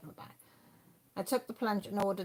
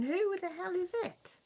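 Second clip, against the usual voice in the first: exasperated asking; 12 semitones higher than usual. Retold or imitated. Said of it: "And I was going".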